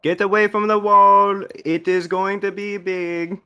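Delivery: loud, high call across the room; calling from far away. Acted. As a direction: yell a warning to a friend across the room. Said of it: "I'll yell over to Gilga".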